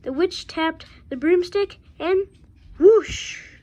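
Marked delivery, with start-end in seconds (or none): 2.8-3.6 whoosh. A narrator's reading. Broom takes off.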